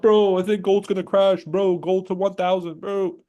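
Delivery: high, mocking whiny voice; mocking whiny chatter. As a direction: sneer like a panicky chatter. Mocking doom-saying chatters in chat.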